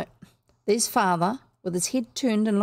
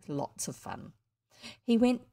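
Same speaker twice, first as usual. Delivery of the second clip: usual speaking voice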